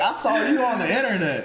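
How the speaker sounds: imitating the boss again. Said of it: "He was like".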